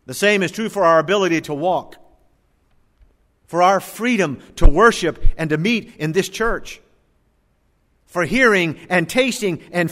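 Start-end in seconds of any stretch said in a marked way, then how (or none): none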